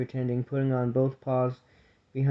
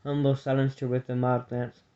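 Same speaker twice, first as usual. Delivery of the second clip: plain reading voice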